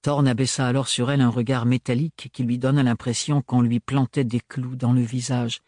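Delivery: ordinary delivery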